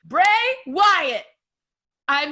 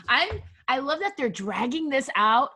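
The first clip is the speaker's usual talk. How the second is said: ordinary talk, nothing marked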